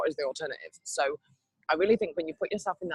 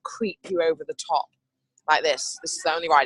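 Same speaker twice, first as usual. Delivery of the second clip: normal talking voice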